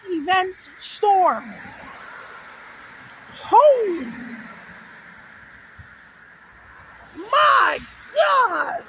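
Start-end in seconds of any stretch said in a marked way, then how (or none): 3.3-8.8 startled shout over the wind; shocked exclamation. Real, not acted. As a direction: blurt it out in awe. Storm chaser reacting to gust.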